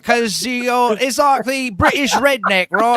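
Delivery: loud, high mock Cockney; hammed-up Cockney voice; Cockney accent. Mocking how Cockneys talk, put on a Cockney accent. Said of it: "The Cockney accent".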